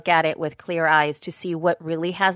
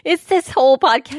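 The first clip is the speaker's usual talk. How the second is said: high, loud teasing question; playful; 9 semitones higher, 6 dB louder than usual. Acted. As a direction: tease them with it, up high.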